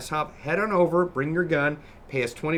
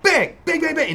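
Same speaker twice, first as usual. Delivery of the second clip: shouted bang bang, high and loud; imitated explosions; 10 dB louder than usual. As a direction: yell the bangs like blasts. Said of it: "and goes".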